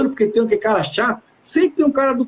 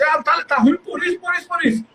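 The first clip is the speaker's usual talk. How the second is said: higher-pitched imitation of a grumbler; mock complaining resident; 6 semitones higher than usual. Resident grumbles to the manager about the work, mock complaining. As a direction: rattle off complaints in a raised pitch.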